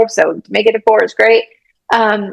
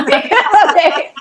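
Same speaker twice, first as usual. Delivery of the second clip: okay, okay, through a laugh; laughing agreement; 8 semitones higher, faster than usual. Retold or imitated. Agreeing to apply again, laughing. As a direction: give in with a laugh. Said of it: "And I was like".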